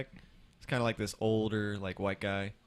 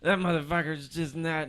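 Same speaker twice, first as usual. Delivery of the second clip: mock angry customer; 7 semitones higher, 5 dB louder than usual. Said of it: "And he's just like".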